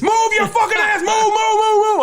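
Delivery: frantic high screamed orders; drill sergeant shout. Retold or imitated. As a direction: yell move like a raging drill instructor. Drill sergeant storms onto bus.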